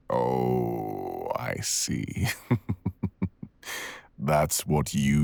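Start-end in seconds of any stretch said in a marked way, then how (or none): 2.3-3.4 mocking laugh. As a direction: laugh at the foolish human. Mocking human morals.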